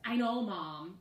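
the wrong tone, irritated; snappy tone. Shown as a demonstration.

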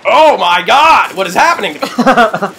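loud, high, drawn-out cry of alarm; overdone dismay at the game; playful panic. Pretend. Mock panic during gameplay.

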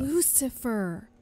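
loud scolding cry; outraged shout. Acted. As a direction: yell the name in outrage.

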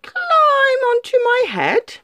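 very high, slow crafty fox; smirking fox. Acted. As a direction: smirk as the trap closes. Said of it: "smirked the fox".

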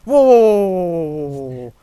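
довгий гучний високий вигук жаху; кричи від переляку; переляканий крик. Real, not acted. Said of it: "Знову я зналякався". (довгий гучний переляканий вигук)

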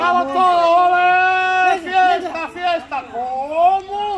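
loud, high party shouting; rally the crowd to celebrate; rowdy party animal. Real, not acted. Calling everyone to party.

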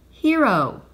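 loud, slow, proclaimed headline; headline announcement. A narrator's reading. Narrator reading the newspaper about Stanley, loud headline style. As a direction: announce it like front-page news, stretching each word.

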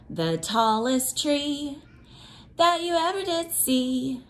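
put-on reading to children. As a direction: chant it like a picture book for little ones. Teacher reads cumulative rainforest book.

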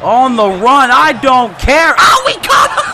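loud shout climbing into high laughter; building excitement, very high by the end; elated. Real, not acted. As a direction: yell in triumph, then crack up. Completes a big catch.

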